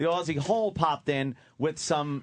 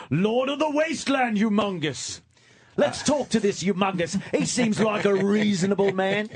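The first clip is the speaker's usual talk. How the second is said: higher-pitched movie quote voice; Road Warrior character; 5 semitones higher than usual. Acted. Quoting Road Warrior on air, in character voice. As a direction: call it out like a film character. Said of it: "fucking Road Warrior".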